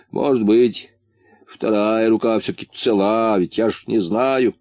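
ordinary delivery, nothing marked

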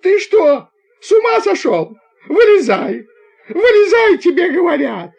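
very high, loud, shrieked orders; frantic shouting. A narrator's reading. Conductor grabbing the boy's boot, frantic shouting. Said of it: "закричала громче прежнего".

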